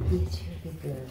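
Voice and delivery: gentle cooing praise. Real, not acted. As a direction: coo it to calm a little one. Soothing pet monkey.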